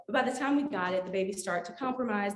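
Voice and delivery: usual speaking voice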